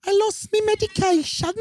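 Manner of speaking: very high mock-British old lady; old Brit impression. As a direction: mimic an elderly Brit in a high voice. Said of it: "an old Brit from Delaware".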